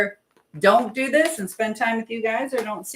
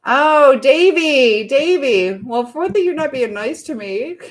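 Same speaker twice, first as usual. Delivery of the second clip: loud, higher-pitched mock telling-off; playful scolding; 4 semitones higher, 7 dB louder, slower than usual. Real, not acted. Host teasing a viewer in the live chat, playful scolding. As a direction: call out the name in loud, teasing reproach.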